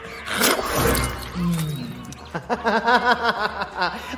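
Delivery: long, high gloating laugh; evil cackle. Acted. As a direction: cackle over the caught prey. Vampire has caught a victim.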